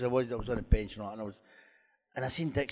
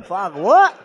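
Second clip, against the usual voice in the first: high, loud startled swear at the telly; shocked outburst; 6 semitones higher, 11 dB louder than usual. Retold or imitated. Hearing his old manager praise him, a loud startled swear. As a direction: blurt it out in disbelief. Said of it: "I'm going".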